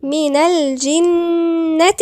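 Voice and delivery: very slow, loud recitation of من الجنة; sounded-lam recitation. Shown as a demonstration. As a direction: voice the ل of الجنة clearly, then hold the doubled n. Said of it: "هنا بقى اللام قمرية".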